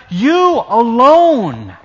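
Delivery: ordinary talk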